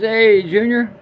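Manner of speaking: high, loud call across the yard; old man calling out. Acted. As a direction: hail the kid from the porch.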